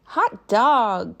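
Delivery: loud, high cheer; cried out. Acted. Kids cheer their teacher's return, loud and happy. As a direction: whoop like a happy kid. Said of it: "cried the kids".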